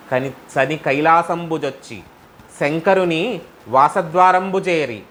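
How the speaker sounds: కైలాసంబుజొచ్చి said slowly, word by word, spoken not sung; slow spoken repeat. Shown as a demonstration. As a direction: break the verse down slowly for listeners. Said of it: "slow spoken explanatory repeat".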